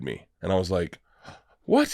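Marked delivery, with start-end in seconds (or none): 1.6-1.9 retold shock. Retold disbelief at haters.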